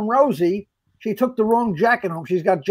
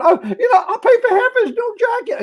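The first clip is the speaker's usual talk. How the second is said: high, tearful imitation of a scared woman; frightened; 13 semitones higher than usual. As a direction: squeak it out like she's about to cry. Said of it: "like, frightened".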